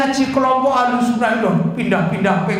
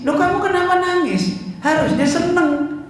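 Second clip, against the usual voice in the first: nada tinggi, pura-pura heran; 10 semitones higher than usual. Retold or imitated. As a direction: perankan tuan yang bingung bertanya. Tuan heran melihat budaknya menangis. (heran, suara meninggi)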